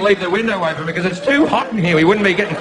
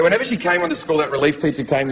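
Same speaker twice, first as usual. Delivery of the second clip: usual speaking voice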